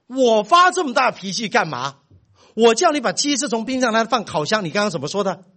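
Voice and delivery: angry retort. Retold or imitated. Father flinging the son's words back at him, angry retort. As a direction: echo his complaint back in fury.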